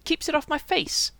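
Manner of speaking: high, sulky protest; determined pout. A narrator's reading. Son defending his hair to his mother, pouting. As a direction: answer back with a pout. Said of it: "and pouted determinedly".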